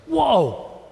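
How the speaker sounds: high, falling whoa of shock; acted alarm, pitched high then dropping; startled reaction. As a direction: recoil at a stern warning. Reacting to the stern warning.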